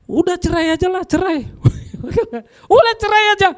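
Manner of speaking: mock flippant advice. Preacher mocking a careless couple, light and laughing. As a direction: toss off the advice like a joke. Mocking the easy divorce answer.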